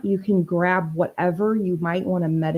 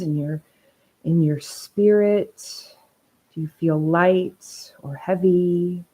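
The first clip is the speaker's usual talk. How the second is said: unhurried, even-toned meditation leading; guided meditation. Acted. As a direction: ease listeners into stillness, phrase by phrase.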